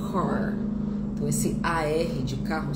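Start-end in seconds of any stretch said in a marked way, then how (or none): 0.1-0.6 car with a firm American R; American English pronunciation. Shown as a demonstration. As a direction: say car, R curled, American style. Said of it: "inglês americano".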